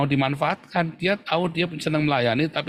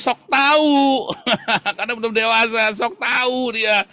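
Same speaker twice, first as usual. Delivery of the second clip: mocking know-it-all; 8 semitones higher, 3 dB louder, slower than usual. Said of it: "Jadi apa, saudara?".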